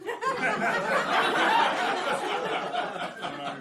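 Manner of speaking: high, soft cackling laugh. Real, not acted. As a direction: break into laughter. Laugh after question.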